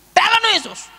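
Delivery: high, loud proclamation; commanding prayer. Retold or imitated. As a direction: declare it over the patient. Healing command.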